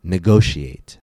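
Negotiate with a loud GO; stress before suffix. Shown as a demonstration. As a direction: punch the second syllable of Negotiate. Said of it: "the stress always falls two syllables before".

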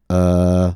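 "Uh" met een ontspannen neutrale tong; "Uh" kort, tong in het midden; uh vowel. Shown as a demonstration. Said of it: "It sounds like this".

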